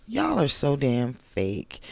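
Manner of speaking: ordinary talk, nothing marked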